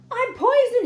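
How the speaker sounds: high, loud anguished howl. A narrator's reading. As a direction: wail it as if poisoned. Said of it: "he howled".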